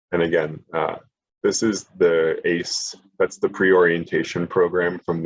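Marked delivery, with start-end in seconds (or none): none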